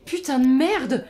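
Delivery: slow, stunned swear; shocked curse. Acted. Eléa seeing Gus beaten up, shocked. Shocked at Gus's bruised face.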